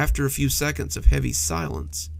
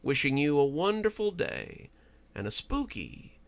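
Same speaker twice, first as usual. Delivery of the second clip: slow, much softer, hushed outro; spooky host sign-off; 5 dB quieter, slower than usual. Acted. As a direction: ease listeners out with a creepy calm. Host Doctor Plague signs off.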